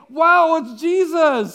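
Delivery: slow, loud, high "wow it's Jesus"; excited shout. Retold or imitated. Disciples hailing Jesus on the water, excited shout. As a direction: shout it out in amazement.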